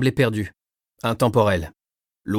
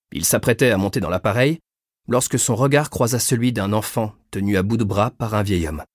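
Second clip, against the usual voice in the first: ordinary delivery, nothing marked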